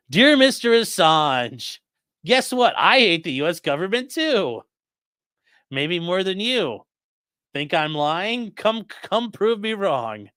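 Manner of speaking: high, slow, hammed-up letter reading; mock letter recital. Streamer dictating a pretend letter to Assange, hammed-up pitch.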